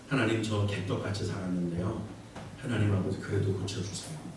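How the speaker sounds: sheepish low prayer. Retold or imitated. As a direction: pray as if ashamed to ask. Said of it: "이렇게 말하는 게 기도에 힘이 있을까요?". (훨씬 낮고 작게 부끄러워하며 하는 기도)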